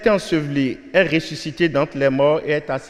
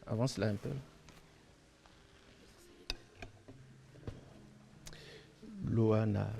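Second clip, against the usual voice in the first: whispering over a crib; 4 semitones lower, 17 dB quieter than usual. Real, not acted. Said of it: "on va essayer de ne pas la réveiller".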